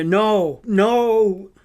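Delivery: narrated scream, held back. A narrator's reading. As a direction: cry out in horrified denial. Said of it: "Sophina and Ruby started screaming".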